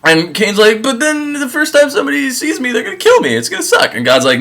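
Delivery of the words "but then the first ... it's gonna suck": much higher, whining complaint; high pleading whine; whiny Cain. Retold or imitated. Cain complaining to God about being killed, much higher whine.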